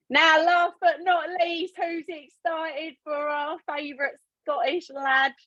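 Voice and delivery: high, slow, drawn-out introduction; host's build-up. Real, not acted. Host introducing favourite Scottish lad to the call, high drawn-out build-up. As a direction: hype up the next speaker, stretching the words. Host hyping up the next speaker.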